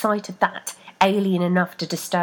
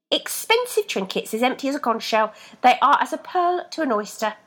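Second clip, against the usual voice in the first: higher scornful voice; scornful foot; 6 semitones higher, 4 dB louder than usual. A narrator's reading. Left scorning Rebecca's shoes, higher scorn. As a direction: dismiss the shoes.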